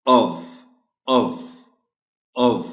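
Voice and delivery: of ending in a v, not f; of with v sound. Shown as a demonstration. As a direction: say of as 'ov', short vowel. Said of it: "इसको बोलना है". (of का उच्चारण ऑव, अंत में व)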